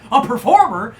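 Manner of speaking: can't believe the word; astonished question; incredulous customer. Acted. Customers puzzled.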